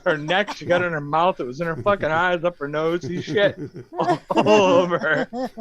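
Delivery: can barely get it out for laughing; cracking up while telling; laughing through it. Real, not acted.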